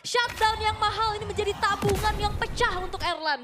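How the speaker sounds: high, loud hype commentary; excited casting. Real, not acted. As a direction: ride the hype of the shutdown. Co-caster hyping the shutdown.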